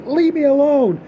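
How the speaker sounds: put-on panicked babysitter shouting into the phone; frightened yell. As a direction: scream at the caller to stop. Frightened babysitter snaps at caller.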